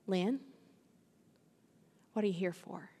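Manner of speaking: soft, intimate question; a gentle inner voice asking; hushed voice of God to Lynn; still small voice. Acted. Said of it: "this still small voice".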